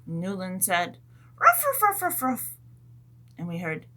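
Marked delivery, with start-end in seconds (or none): 1.4-2.5 very high yappy barking; dog barks. Pretend. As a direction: yap like a little dog.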